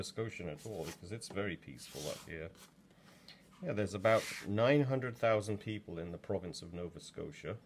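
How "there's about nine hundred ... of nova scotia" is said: ordinary talk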